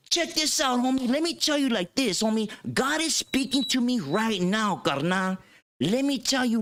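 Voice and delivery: high, pumped-up preacher voice; imitating barrio preacher. Preacher promises the vatos Jack in the Box, high hyped preaching. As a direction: sell salvation like a hype man.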